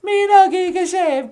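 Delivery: very high repeat of the greeting; [name]'s puppet voice. Acted.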